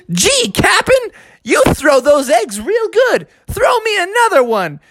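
put-on high, gleeful admiring kid; Froggy. Boy praising the captain's throws, gleeful. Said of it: "He was like".

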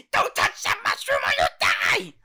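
frantic shouted cartoon warning; Toad. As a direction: yell it as an alarmed little mushroom character. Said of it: "warning Mario about one of the mushrooms".

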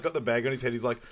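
usual speaking voice

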